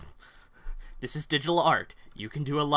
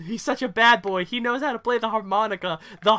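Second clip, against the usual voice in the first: gushing fangirl, higher and louder; swooning fangirl; 7 semitones higher, 4 dB louder than usual. Retold or imitated. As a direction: gush like a smitten teen.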